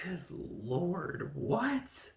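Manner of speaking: drawn-out "good Lord", high-pitched "what"; slow build to a high incredulous question. Real, not acted. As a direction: stretch it out, then squeak in disbelief. Exaggerated disbelief at the plot.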